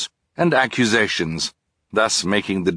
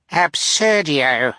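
put-on slow, higher toddler voice; baby-talk word; 9 semitones higher, 3 dB louder, slower than usual. A narrator's reading. Baby Sunny objecting to the philosopher idea, slowly. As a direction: say it like a little baby. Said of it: "Sunny said".

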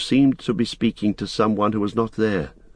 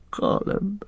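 high, weak throat whimper; Gollum whimpering; 12 semitones higher, slower than usual. Acted. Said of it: "he whimpered".

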